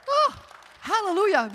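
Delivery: hallelujah shouted high and loud; joyful shout. Retold or imitated. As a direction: burst out with pure joy. Celebrating being cancer-free.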